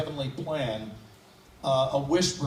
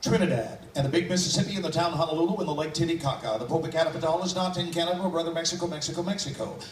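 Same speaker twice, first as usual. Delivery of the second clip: fast, crisp tongue-twister patter; rapid vocal warm-up; much faster than usual. Shown as a demonstration. Vocal warm-up, timed by an audience member. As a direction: rattle it off against the clock. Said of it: "this is called a vocal warm-up".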